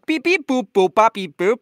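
high, loud beeping; robot beeps. Acted. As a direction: beep back at the robot. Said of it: "he doesn't speak Eng".

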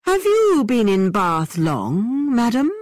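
high, slow, overly courteous gentleman; bow and ask with mock formality; exaggerated polite voice. A narrator's reading. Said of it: "in an exaggerated, extremely polite voice".

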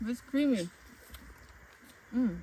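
usual speaking voice

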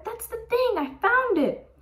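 put-on very high, thrilled kid voice; excited scream. Arthur finds the thing in game, screaming. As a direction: shoot the pitch way up in triumph. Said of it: "screamed Arthur".

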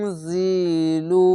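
ইনজিলু drawn out, nasal ng held; slow recitation. Shown as a demonstration. Teacher shows nasal ghunna to students, slow recitation. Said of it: "এক আলিফ টেনে পড়বেন".

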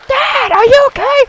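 put-on high squeaky child worry; high son voice. A son asks his dad, high and squeaky. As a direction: ask like a little boy.

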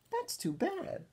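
high, sing-song fake sympathy; mock pity. D W taunting her sick brother with fake pity. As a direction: pretend to feel sorry. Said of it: "D W taunting Arthur".

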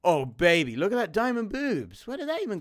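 loud, high thrilled shout over diamonds; excited. Real, not acted. Gamer thrilled at spotting diamonds, loud excited. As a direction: yell with delight at the find.

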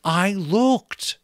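Molly defending herself to the glaring magician; small nervous reply. A narrator's reading. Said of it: "glared at her in vast silence".

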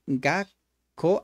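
"gakko" very slowly, with a held gap before the second syllable; doubled consonant. Shown as a demonstration. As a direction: split "gakko" as gak, pause, ko. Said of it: "la siguiente, eh, consonante es como si se doblara".

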